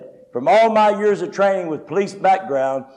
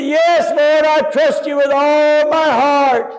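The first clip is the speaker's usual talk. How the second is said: high, fervent, drawn-out holler; shouted avowal; 7 semitones higher, 3 dB louder, slower than usual. Retold or imitated. Dangling man swears to God he trusts him, hollering. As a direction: holler your trust up to heaven.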